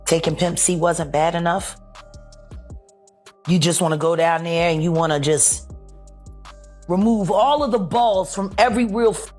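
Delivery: angry rant. Real, not acted.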